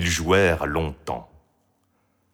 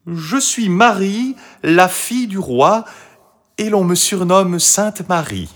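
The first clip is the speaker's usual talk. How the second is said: put-on high, loud girl's declaration; proud; 11 semitones higher, 6 dB louder than usual. A narrator's reading. Marie answers the voice behind the door, proud and high. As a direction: announce yourself proudly and boldly. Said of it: "répondit fièrement".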